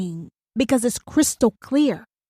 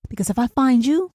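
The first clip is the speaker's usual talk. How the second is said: normal talking voice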